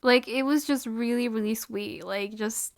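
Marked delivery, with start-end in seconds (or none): none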